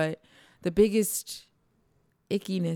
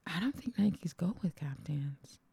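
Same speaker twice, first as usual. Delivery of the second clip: whispering; 10 dB quieter than usual. Real, not acted. Whispered aside.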